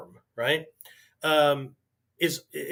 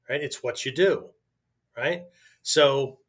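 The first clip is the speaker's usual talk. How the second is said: ordinary talk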